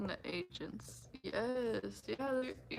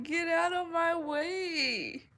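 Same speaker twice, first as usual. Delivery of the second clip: slow, drawn-out put-on voice; mock rude shopper; 7 semitones higher, 4 dB louder, much slower than usual. Imitating a pushy shopper blocking the aisle, slow and mocking. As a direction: drag out the rude demand. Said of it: "You're like".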